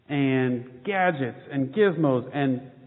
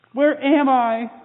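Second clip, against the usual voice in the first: scared chick; 8 semitones higher, 6 dB louder, slower than usual. Acted. Baby bird lost, frightened.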